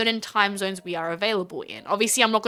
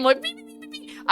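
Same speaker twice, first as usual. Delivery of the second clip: censor beeps; 6 dB quieter than usual. Retold or imitated.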